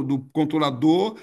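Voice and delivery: ordinary talk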